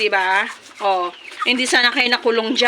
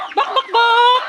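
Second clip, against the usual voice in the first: high chicken-call clucks; 11 semitones higher, slower than usual. Real, not acted. Vlogger calling her chickens to feed with clucks.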